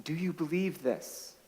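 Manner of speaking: soft, quiet gentle asking; gentle question. Retold or imitated. Jesus gently asking Martha, soft quiet question. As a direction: ask it softly, almost intimate. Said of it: "and he says to Martha".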